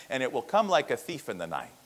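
usual speaking voice

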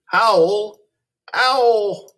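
high rising howl like a wolf. Shown as a demonstration. Teacher models the ow sound by howling like a wolf. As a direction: stretch it out, "howl, howl, owl". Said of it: "modelling owl sounds in word list".

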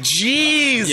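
high, long drawn-out exclamation; exasperated disbelief. Acted. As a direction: stretch it in comic disbelief. Reacting to the shouting owner.

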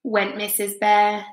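plain reading voice

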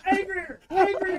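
high, urgent prompt; coaching call. Real, not acted. Director urging the actor, high-pitched. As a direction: push them from the side, up high.

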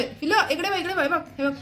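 high sing-song attention call. Real, not acted. Getting toddler to look.

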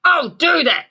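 loud high screech; grouchy ladybug screech. A narrator's reading. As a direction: screech it in a huff. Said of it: "screeched the great grouchy ladybug".

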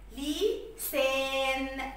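لسان very slow, split after لي, ending held level; stretched long vowel. Shown as a demonstration. Teacher finishing writing the word, stretching لسان. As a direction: draw out the aa and n of لسان. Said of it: "لما نمد كده في الصوت".

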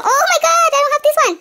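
excited gasp. Real, not acted. Finds a new mold.

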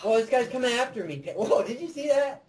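high-pitched, startled outburst; excited alarm. Real, not acted. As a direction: exclaim as you are being chased. Enemy suddenly comes after player.

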